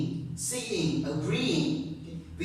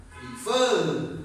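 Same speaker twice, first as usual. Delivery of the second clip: "prefer" as pre-FER; stress on second syllable. Shown as a demonstration. Teacher explaining why we double, "prefer" stressed on the end. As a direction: lean hard on the "fer" of "prefer". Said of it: "fur is stronger than pre".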